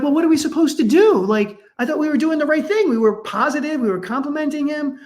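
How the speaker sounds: bewildered parents. Retold or imitated. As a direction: ask as though the ground gave way. Parents confused, upset by diagnosis.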